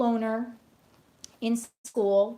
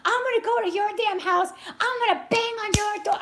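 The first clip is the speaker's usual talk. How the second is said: much higher and louder, angry retort building up; retold angry outburst; 12 semitones higher, 3 dB louder than usual. Yelling back at the lady through the bathroom door, angry. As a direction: tell her off, reliving the fury. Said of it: "I was flipping out".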